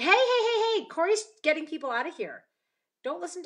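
high, loud rapid-fire objection; calling out in protest. Real, not acted. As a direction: shout to stop everyone leaving. Mock protest at Corey sending viewers away.

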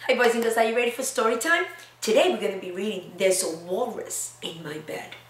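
normal talking voice

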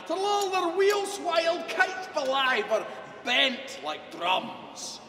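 booming dramatic Scottish recitation; poem recitation. Acted. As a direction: declaim the poem with full theatrical force. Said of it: "the address to a haggis".

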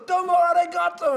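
high, loud call to the crowd; hammed-up DJ shout; hype-man emcee. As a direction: throw it out for the fans to answer. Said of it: "He goes".

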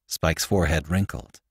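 plain reading voice

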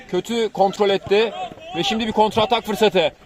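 ordinary talk

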